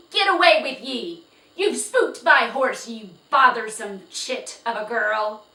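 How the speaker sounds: high, very loud scolding yell; angry yell. Acted. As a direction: yell the girl away. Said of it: "yelled at Felicity".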